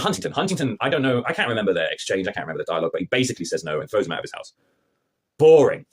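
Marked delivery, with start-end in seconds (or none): 5.4-5.8 punchy, raised-voice verdict; loud dismissal. Real, not acted. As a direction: blurt it out loudly. Dismissing the scene.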